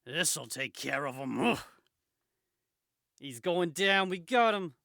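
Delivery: loud, high-pitched cartoon thugs; rally the gang in hammed-up shouts; agitated crooks. Crooks rushing Spider-Man together, loud and agitated.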